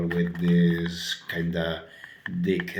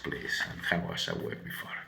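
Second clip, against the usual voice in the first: quiet aside; 2 semitones lower, 11 dB quieter, faster than usual. Real, not acted. Aside after vegan joke.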